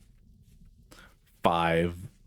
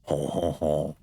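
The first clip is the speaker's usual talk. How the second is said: mock French laugh. Streamer making fun of the French, mock French laugh. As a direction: chuckle in a cartoon French accent. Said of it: "make fun of the French".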